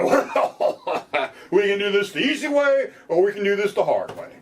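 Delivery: high, laughing imitation of a smug cop; mock cop threat. Cop threatening the suspect, mock smug and high. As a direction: tease it like a bullying officer.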